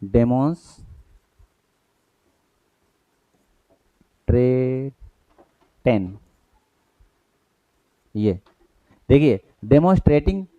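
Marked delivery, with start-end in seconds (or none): 0.0-6.2 डेमोन्स्ट्रेटेन drawn out extremely slowly, syllable by syllable; dictation-pace डेमोन्स्ट्रेटेन; slow spelled-out word. Shown as a demonstration. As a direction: stretch डेमोन्स्ट्रेटेन out for students to copy. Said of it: "fourth इससे बनाएंगे".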